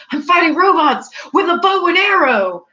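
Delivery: high, put-upon exasperated whine; mock exasperated hero voice; exasperated Hawkeye. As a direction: play the fed-up archer. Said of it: "he seems always exasperated".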